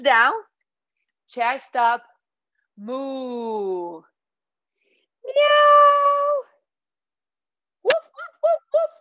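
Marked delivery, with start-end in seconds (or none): 2.8-4.0 long, soft imitated moo; cow moo. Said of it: "let's do these three animals". 5.2-6.3 very high, loud meow; cat meow. Pretend. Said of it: "let's do these three animals". 7.8-8.9 very high yappy woofs; dog woof. Pretend.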